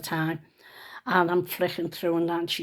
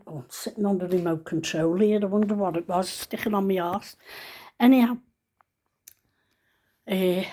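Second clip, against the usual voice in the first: usual speaking voice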